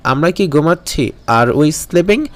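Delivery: ordinary delivery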